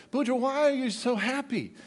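high-pitched, puzzled question; lightly acted, mystified devil; baffled Satan. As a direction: ask it in high bewilderment. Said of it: "Satan's confused".

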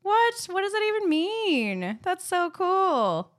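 high, loud amazed questions; amazed disbelief. Real, not acted. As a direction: gush in stunned delight. Reacts to award news.

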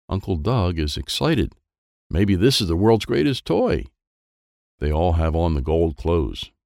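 plain reading voice

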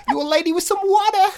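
the cartoon Bostonian pushing the one practised sentence; Boston accent. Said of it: "Can you only say that one sentence".

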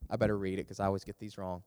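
ordinary talk

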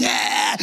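imitated drawn-out scream; mock scream. Preacher imitating God's eight billion kids, mock screaming. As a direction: scream like a kid in the backseat. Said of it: "all of us are screaming".

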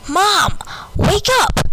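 high-pitched wake-up call; high, urgent holler to mom. A narrator's reading. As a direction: call out high to rouse a sleeping mother. Child calls to sleeping mother.

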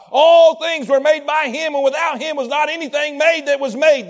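preaching at full force. Acted. Preacher proclaiming Jesus to the church, loud and ringing. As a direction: preach it out to the congregation.

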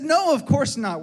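high, loud retold denial; acted dad's emphatic no; emphatic reassurance. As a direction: dismiss the child's worry with big emphasis. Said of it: "And I said".

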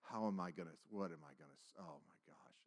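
low, very soft nervous self-talk. Retold or imitated. Teller facing a crowd of students, nervous murmur. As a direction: mumble it under your breath, retelling panic. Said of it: "You're just nervous".

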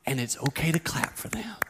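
gentle whispered aside. Real, not acted. Pastor telling parents to let kids clap, whisper. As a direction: whisper it kindly. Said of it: "unvoiced whisper".

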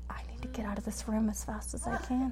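unvoiced whisper of panic; whispered thought. She silently wants to flee the room, whispered.

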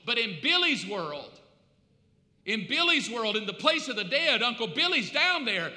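loud, driving sermon delivery; impassioned preacher in full flow; full-force preaching. Real, not acted. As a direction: preach it hard, punching the key words.

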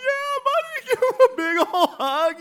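high, loud laughing squeal; delighted agreement. Retold or imitated. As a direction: gush it loudly in hammed-up joy. Retold delighted yes to a hug.

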